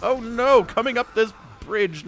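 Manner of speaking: ordinary talk, nothing marked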